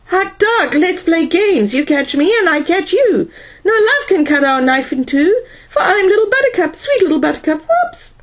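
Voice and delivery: high, giddy robot voice; silly high-pitched machine voice; drunken robot. A narrator's reading. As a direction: play a tipsy robot babbling in a high sing-song. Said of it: "Speedy's robot voice sounded in Powell's earphones".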